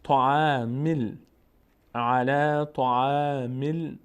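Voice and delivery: طعام ال- joined slowly, mim running into lam; joined-up recitation. Shown as a demonstration. Teacher showing learners how mim joins lam, slow joined-up recitation. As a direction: draw out each syllable, linking the mim to the lam. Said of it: "میم میچسبه به لام".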